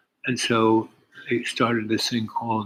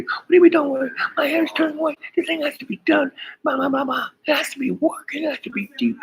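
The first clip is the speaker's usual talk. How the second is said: high, frantic imitation of a panicked boss; hammed-up panicky manager, much higher and louder; mock panic; 4 dB louder than usual. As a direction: squeal it like he's losing it. Panicked client quoted on phone.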